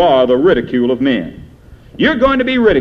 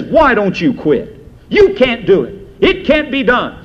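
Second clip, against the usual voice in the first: higher, pushing taunt; taunting devil voice; 5 semitones higher than usual. Retold or imitated. The devil taunting discouraged Christians to quit, rising and pressed. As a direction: goad them like the tempter. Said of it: "the devil whispers in your ear".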